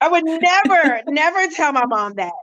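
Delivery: high, incredulous never; shocked friends. Retold or imitated. High-pitched disbelief as her friends. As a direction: friends recoiling at the idea. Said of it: "And they were like".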